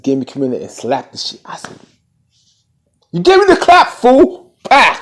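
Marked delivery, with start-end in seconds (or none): 3.1-4.5 high, loud, indignant yell; mock outraged yell. Confronting the one who gave the clap, mock yell. Acts out confrontation before a slap. 4.7-5.0 loud, sudden "bah" of a slap; imitated slap noise. Acting out the slap in his face, imitated smack.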